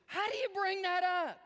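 high, loud imitation of curious listeners; exclaimed mock question. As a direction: blurt it out like an amazed questioner. Voicing people's curious question.

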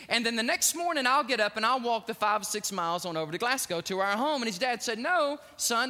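booming pulpit storytelling; preaching at full force. Acted. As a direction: preach it out to the whole church. Preacher retells father's words.

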